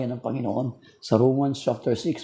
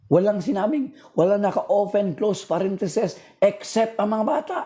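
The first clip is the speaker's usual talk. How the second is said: fiery preaching; 7 semitones higher than usual. Acted. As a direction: preach it out to the whole church. Preaching to the congregation.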